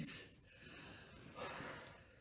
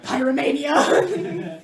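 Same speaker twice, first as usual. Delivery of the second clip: high, loud laughing shout; hammed-up, elated exclamation; gleeful cry; 7 semitones higher, 10 dB louder, much slower than usual. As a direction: draw it out like a mock battle cry. Player gleefully announces burning it.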